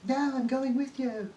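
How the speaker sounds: high, insistent imitation of Leia; mock Leia. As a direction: squeak it out like a stubborn princess. Leia voiced in fan rewrite.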